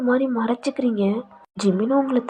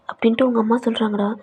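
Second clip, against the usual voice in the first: usual speaking voice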